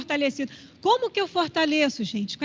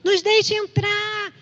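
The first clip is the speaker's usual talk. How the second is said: high, drawn-out pleading call; raised, stretched-out plea to be let in; crying out; 7 semitones higher, much slower than usual. Acted. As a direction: call out as if locked outside a door. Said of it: "batendo na porta e gritando".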